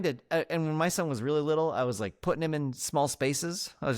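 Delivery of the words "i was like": ordinary talk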